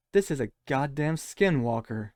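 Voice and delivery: normal talking voice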